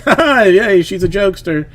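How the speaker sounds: high, fake-cheery laugh it off; covering embarrassment. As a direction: play it off lightly for the other parents. Covers embarrassment before other moms.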